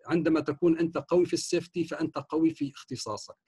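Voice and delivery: normal talking voice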